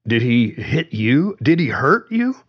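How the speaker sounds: rising alarm, pitch climbing on the second question; a worried dad pressing for answers; alarmed father. A narrator's reading.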